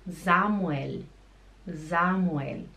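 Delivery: "Samuel" starting with a Z sound; German pronunciation. Shown as a demonstration. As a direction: say "Samuel" as ZA-mu-el, ending on a clear L. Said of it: "como que fala Samuel em, em alemão".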